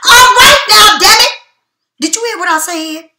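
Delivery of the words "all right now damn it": suddenly loud, high burst of frustration; loud exclamation. Real, not acted. Reader exclaiming at her tarot cards, loud and high.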